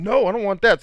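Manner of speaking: retold high, loud, hurried no; alarmed refusal. Gamer retelling his answer to the takedown request, alarmed. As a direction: act out an urgent, alarmed refusal.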